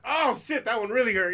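high, loud mock yelp of pain. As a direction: yell it like you just got hit hard. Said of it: "I'm like".

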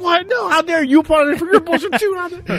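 hammed-up indignant shriek at the wife; mock furious yell. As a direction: shriek it like a wounded toddler. Retold flare-up at wife.

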